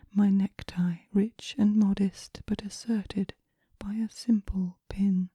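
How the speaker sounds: normal talking voice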